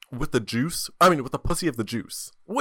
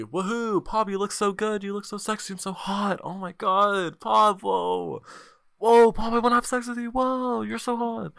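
high squealing fan voice, pitched way up; imagined adoring fan; 10 semitones higher than usual. Pretend. Fan cheering for Pab's new hair, high and squealy. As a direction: squeal like a fan gushing over you. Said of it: "talking like that the entire time".

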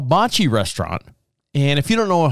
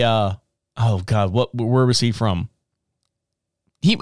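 ordinary talk, nothing marked

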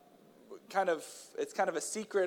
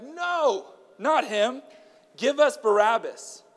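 loud, higher shout of the mob; crowd shouting; 8 semitones higher, 6 dB louder, much slower than usual. A narrator's reading. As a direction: yell it back at Pilate, slower on Barabbas. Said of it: "They shouted back".

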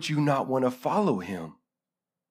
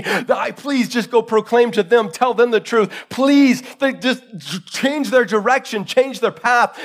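raised, urgent begging voice; desperate pleading; 6 semitones higher, 4 dB louder than usual. Acted. Rich man begs for his brothers to be warned, desperate pleading. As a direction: plead hard at a higher pitch.

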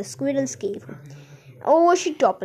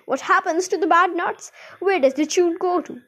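higher-pitched, urgent motherly questions; frantic questioning; 6 semitones higher, 4 dB louder than usual. A narrator's reading. Mother asks about the rubbish chute, frantic. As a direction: press for answers in acted alarm.

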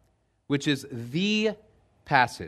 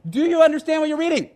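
high, fast shout alongside a chariot; calling while running; 14 semitones higher, 7 dB louder, faster than usual. Acted. Philip running beside the Ethiopian's chariot, calling up to him. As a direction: call it out high and hurried, mid-run. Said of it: "he's running, and he goes".

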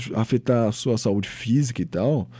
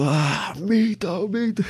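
mock fan cheer; 7 semitones higher, much slower than usual. Mocking fan shouting.

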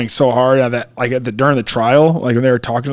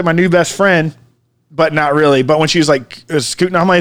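normal talking voice

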